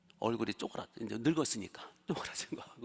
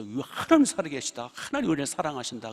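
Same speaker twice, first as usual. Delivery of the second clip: excited praise; 3 semitones higher, 7 dB louder than usual. Retold or imitated. Israelites praising God, retold.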